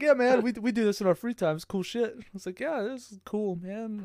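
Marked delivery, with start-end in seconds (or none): 0.0-2.1 lightly higher, easygoing big guy; Lopez casual. Retold or imitated. Lopez saying they do it for fun, easygoing. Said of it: "He's like". 2.6-4.0 higher, softer, uneasy agreement; nervous agreement. Retold or imitated. Narrator nervously agreeing before running off.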